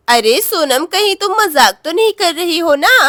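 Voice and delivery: ordinary delivery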